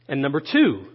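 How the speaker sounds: normal talking voice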